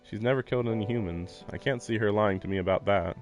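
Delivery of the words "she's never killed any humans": usual speaking voice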